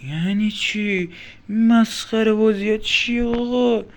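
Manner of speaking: high, loud, drawn-out protest; incredulous outrage. Real, not acted. Player protests being sent back to the start, incredulous outrage. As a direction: drag the words out in indignant disbelief.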